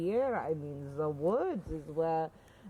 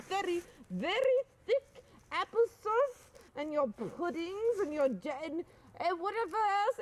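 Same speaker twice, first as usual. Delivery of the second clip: indignant; 7 semitones higher than usual. Acted. Offended carer threatens silent service.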